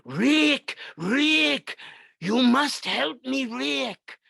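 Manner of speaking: high, loud, pleading cry for Rick; Peter Lorre impression. Every fifties kid begging Rick in a high, loud, desperate cry. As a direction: beg Rick in a desperate, whiny movie-villain voice. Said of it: "invoked that voice of Peter Lorre".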